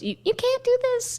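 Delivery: very high protesting voice; high squeaky Ash. Retold or imitated. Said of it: "Ash goes".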